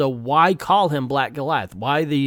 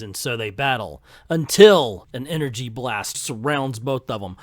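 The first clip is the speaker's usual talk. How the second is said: somber narration; 4 semitones lower than usual. A narrator's reading. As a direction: read it like a brooding narrator. Dramatic narration caption read.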